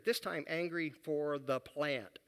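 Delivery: ordinary talk, nothing marked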